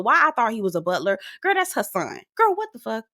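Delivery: ordinary talk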